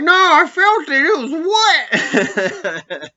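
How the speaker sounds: high, loud put-on voice insisting he felt it; indignant insistence. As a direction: pitch way up and protest, trailing into a laugh. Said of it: "he's like".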